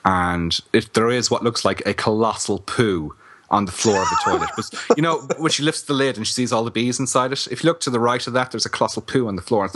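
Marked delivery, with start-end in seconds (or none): none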